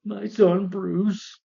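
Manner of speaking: stretched, high, slow mock-solemn call; dramatic father. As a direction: draw it out like a hammy movie father. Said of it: "do you see David Sharp".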